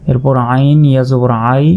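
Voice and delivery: ordinary delivery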